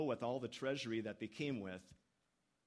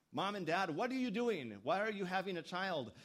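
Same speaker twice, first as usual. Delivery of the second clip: put-on impertinent child; 6 semitones higher, 3 dB louder than usual. Child questioning parents, acted quote.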